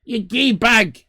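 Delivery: fed-up yell at the taunt; irritated shout. Real, not acted. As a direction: shout down the heckler. Snapping back at a heckler.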